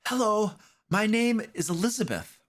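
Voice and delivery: put-on high, polite little girl greeting strangers. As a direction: pitch up into a small girl's voice. DM voices the girl Elizabeth.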